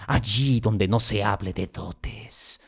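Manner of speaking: ordinary talk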